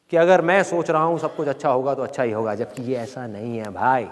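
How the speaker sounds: ordinary talk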